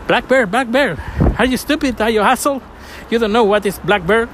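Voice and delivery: high, scornful mock put-down; mocking locals. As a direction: ridicule him for mangling the name in a mock high voice. Locals mocking his pronunciation.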